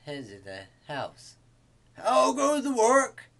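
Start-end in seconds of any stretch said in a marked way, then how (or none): 1.9-3.3 high, loud farmer's holler; farmer calling out. A narrator's reading. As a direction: call it out loudly across the farmyard. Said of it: "called the farmer".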